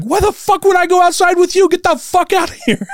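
very high mock screech, breaking into a laugh; retold yelling. Refusing to go outside with protesters, mock yelling. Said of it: "And I'm just yelling".

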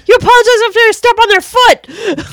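high, loud indignant imitation; mock outrage. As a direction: protest in hammed-up, rising disbelief. Quoting outraged shooting victim in film.

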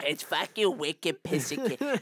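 slow, hammed-up New England accent; mock New England townie. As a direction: lay on the New England slang thick. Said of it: "Speaking of New England".

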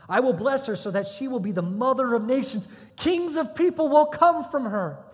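loud, high, building declaration; proclaiming. Acted. Preacher reads God's promise to Abraham, proclaiming loudly. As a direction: read it out as a ringing promise.

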